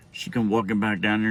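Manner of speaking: ordinary delivery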